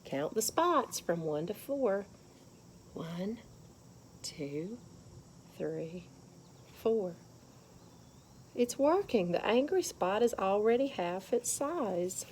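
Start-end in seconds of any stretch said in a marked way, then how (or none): 2.9-7.1 soft counting. Shown as a demonstration. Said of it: "soft counting demo".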